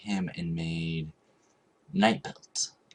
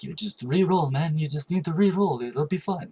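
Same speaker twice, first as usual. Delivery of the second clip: a high, louder imitation of a Middle Eastern accent; 10 semitones higher, 5 dB louder than usual. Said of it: "That was, like, Middle Eastern accent".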